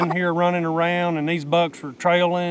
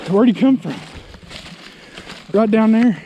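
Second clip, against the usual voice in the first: louder, higher, eager asking; excited questions; 4 semitones higher, 4 dB louder than usual. Real, not acted. Asking his partner where the buck came from, loud and eager. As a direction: fire the questions off keenly.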